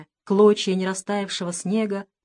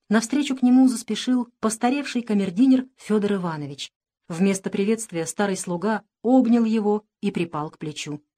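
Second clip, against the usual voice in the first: plain reading voice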